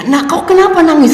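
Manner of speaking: high, loud anxious mother's question; a mother alarmed at her crying child; worried mother. Retold or imitated. Mother asks her crying daughter why, high and anxious.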